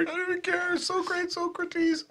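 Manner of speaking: high, soft squeaky falsetto; mock fan falsetto. A bandmate echoes the fan in a soft falsetto. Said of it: "mock fan voice".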